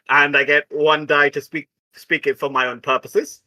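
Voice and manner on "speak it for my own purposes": ordinary talk, nothing marked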